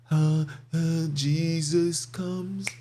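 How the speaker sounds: very slow, mock-pious drawn-out words; mocking drawl. Preacher mocking believers waiting for Jesus, slow mock drawl. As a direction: mimic the dreamy waiting of the faithful.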